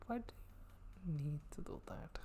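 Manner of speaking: unvoiced whispered aside. Real, not acted. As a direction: drop to a breathy whisper, as if to yourself. Aside question under breath.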